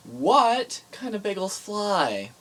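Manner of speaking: slow, loud, drawn-out setup; joke setup. Pretend. Milk the setup like a showman. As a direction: [name] hamming up a bad joke for Caleb, drawn out. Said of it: "we have a bad joke for you".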